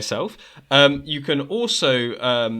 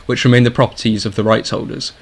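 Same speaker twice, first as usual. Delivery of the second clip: louder, steady legal disclaimer voice; formal disclaimer read; 8 dB louder than usual. Acted. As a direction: read it like official small print.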